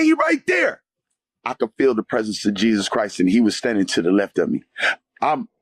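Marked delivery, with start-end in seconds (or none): 0.0-0.8 very high insistent call; retold fervent shout. Telling the friend Jesus stands beside him, retold high shout. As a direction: call it out high, pointing someone out. Retells his own fervent answer.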